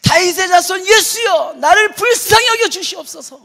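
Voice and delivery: slow, very loud, high crying shout; desperate shout. Retold or imitated. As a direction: scream it over the crowd to Jesus. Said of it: "더 크게 소리 질러서".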